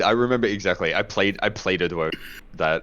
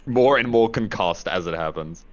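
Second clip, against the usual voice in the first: ordinary talk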